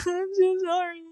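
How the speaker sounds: high, squeaky mock apology; small, very high pretend sorry; imitated cowed apology. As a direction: whimper it like a scolded kid. Mimics scolded child apologizing to mom.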